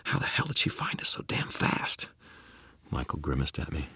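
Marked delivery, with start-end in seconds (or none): 0.1-2.1 harsh, unvoiced whisper; urgent whisper. A narrator's reading. Hunted man to his companion, urgent whisper. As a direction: whisper it in alarmed disbelief.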